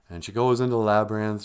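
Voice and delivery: ordinary delivery, nothing marked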